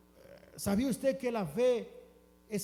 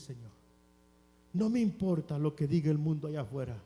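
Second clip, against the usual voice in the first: ordinary talk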